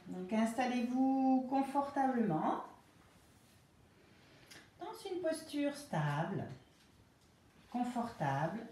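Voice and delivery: slow, calm Yoga Nidra guidance with long pauses; guided relaxation. Real, not acted. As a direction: ease them into rest, phrase by unhurried phrase.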